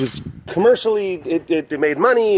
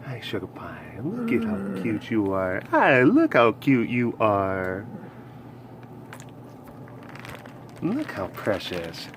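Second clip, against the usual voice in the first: cooing; 6 semitones lower, 7 dB quieter than usual. Real, not acted. Admiring a cute pet, soft cooing. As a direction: coo softly, drawing words out.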